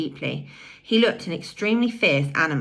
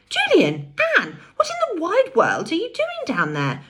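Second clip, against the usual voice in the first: astonished cry; 12 semitones higher than usual. A narrator's reading. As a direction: cry out in amazement. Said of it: "cried Uncle Quentin".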